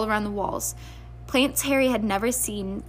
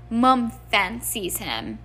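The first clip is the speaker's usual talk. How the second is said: usual speaking voice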